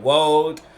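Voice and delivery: road said as woad, R turned to W. Retold or imitated. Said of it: "I would say, like".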